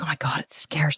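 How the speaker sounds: hushed, acted dread; scared whisper. Client admitting the stakes scare her, whispered. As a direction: whisper it as an awed confession. Retelling her fear of losing yoga.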